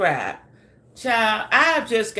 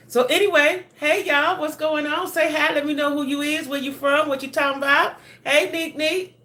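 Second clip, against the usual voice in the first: excited greeting; 9 semitones higher than usual. Real, not acted. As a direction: sing out a big welcome to the viewers. Bright greeting to live chat.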